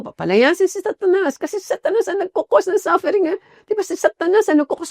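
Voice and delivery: ordinary talk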